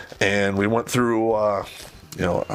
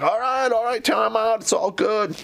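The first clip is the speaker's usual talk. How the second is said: higher, mock soothing backpedal; calm the boss down, playfully; placating; 12 semitones higher than usual. Soldier placating his commander, higher and mock soothing. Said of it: "And I'm like".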